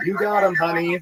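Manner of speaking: high mom cheering from the stands; imitating yelling mom. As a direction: call out encouragement in a high mom voice. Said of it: "my mom yelling".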